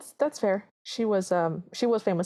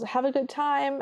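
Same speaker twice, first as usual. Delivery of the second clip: higher-pitched mock call after someone; 7 semitones higher, slower than usual. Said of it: "Cinderella calls".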